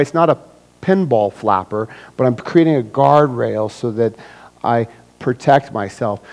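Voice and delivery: ordinary talk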